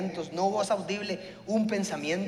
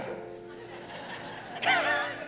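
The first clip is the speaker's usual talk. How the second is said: breathy high laugh at himself; real amused laugh; high unvoiced laugh; 6 dB quieter than usual. Real, not acted. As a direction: laugh at your own stubbornness. Laughs at his own doubting.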